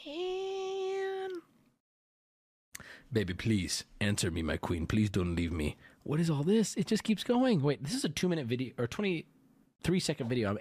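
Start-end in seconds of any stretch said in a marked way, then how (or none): 0.1-1.3 very high, thin, drawn-out moan; pained exclamation. Real, not acted. Streamer reacts to the pathetic texts, high pained moan. 3.1-5.8 low, softer begging read; mock pleading. Streamer reads man's plea to his queen, low mock begging.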